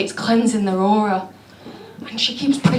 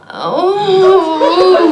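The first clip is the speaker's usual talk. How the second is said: imitated noise; 9 semitones higher, 6 dB louder than usual. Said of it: "she lets out this noise".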